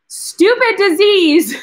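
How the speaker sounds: high, loud, slow, breaking with a laugh-sob; crying out. Real, not acted. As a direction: let the anger and tears burst out. Emotional appeal about childhood cancer.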